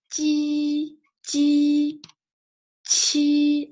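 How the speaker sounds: "Ji" and "Qi" held long on one high note; high level syllables. Shown as a demonstration. Teacher drilling sounds for learners, high level tone.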